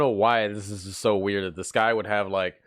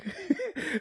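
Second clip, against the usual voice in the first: short high-pitched laugh; 9 dB quieter than usual. Real, not acted. Laugh after impression.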